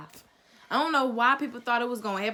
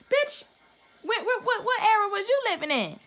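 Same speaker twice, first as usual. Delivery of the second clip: high-pitched, stammering disbelief; incredulous outrage; 10 semitones higher than usual. Real, not acted. Answering an excuse for blackface, incredulous outrage. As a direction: fire back in disbelief, pitch way up.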